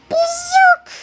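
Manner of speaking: high, loud electric zap; zap sound effect. A narrator's reading. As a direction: imitate a ray gun blast.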